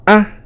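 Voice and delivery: "Ah!" sung clearly high and loud. Shown as a demonstration. Teacher leading the class in a high tone. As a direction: lift "Ah!" well above the voice. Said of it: "Say it with me".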